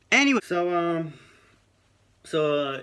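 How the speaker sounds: bursts back in high and loud; loud reset. Real, not acted. After saying sorry to viewers, a loud high restart. Resumes after apologising again.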